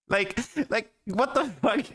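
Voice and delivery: high incredulous what the fuck; can't believe it, half laughing; exasperated disbelief. Real, not acted.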